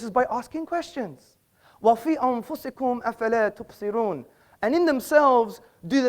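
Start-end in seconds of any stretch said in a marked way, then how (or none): 1.8-4.2 Quran recitation. Acted. Quotes Quran verse in Arabic.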